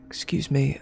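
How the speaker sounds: hushed whisper aside. Acted. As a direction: lean in and whisper. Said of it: "and goes".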